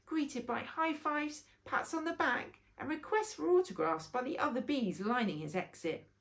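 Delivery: plain reading voice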